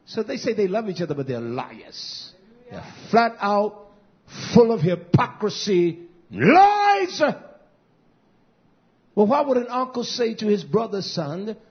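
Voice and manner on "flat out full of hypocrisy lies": preacher's rising shout; shouted climax. Real, not acted. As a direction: cry out the word lies.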